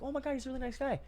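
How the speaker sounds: high, airy gushing praise; mock coworker swooning. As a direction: gush like a fan about a nice guy.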